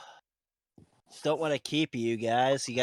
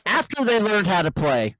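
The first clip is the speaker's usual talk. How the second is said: mic peaking; 5 semitones higher, 5 dB louder than usual. Real, not acted. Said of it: "your mic is peaking so bad".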